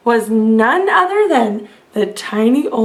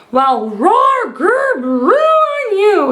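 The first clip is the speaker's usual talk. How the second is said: giant yell; 7 semitones higher, slower than usual. A narrator's reading. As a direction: throw their noises back at them. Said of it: "he yelled".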